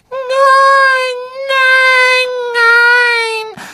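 schrilles, sehr hohes Quieken, fast weinend; quiekend. Acted. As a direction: panisch quieken. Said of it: "quiekte Dobby". (panisch quiekender Hauself, sehr hoch)

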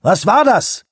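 high, loud alarmed call. Acted. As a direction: shout the question across deck. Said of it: "rief Johansson".

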